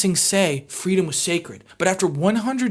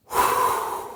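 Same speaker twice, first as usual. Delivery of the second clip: overwhelmed sigh; 4 dB quieter than usual. Real, not acted. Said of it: "I need a minute".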